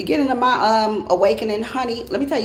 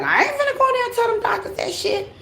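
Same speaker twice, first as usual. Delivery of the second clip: much higher, sassy self-quote; defiant refusal; 14 semitones higher than usual. Retold or imitated. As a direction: refuse it like a stubborn younger self. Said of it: "So I was like".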